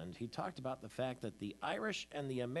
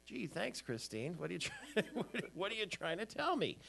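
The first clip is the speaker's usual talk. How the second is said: higher, laughing through a mock hurt reply; mock offended, laughing; 8 semitones higher than usual. Husband answering his wife about the pig cover, laughing mock hurt. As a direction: play wounded while cracking up. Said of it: "and I'm like".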